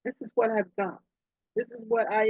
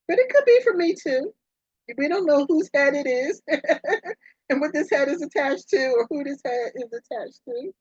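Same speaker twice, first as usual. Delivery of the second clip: giggly excitement; 6 semitones higher, 3 dB louder than usual. Real, not acted. Joking about turning heads.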